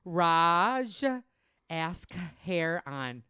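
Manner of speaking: slow, exaggerated Minnesotan accent. Shown as a demonstration. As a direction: sound out the name in a drawn-out Minnesotan accent. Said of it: "We're gonna go Minnesotan".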